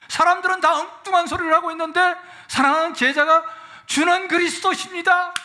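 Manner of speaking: high, loud fervent preaching, slowed and near tears; impassioned sermon climax, laughing and choking up; preaching at full force. Acted.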